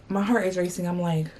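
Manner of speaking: usual speaking voice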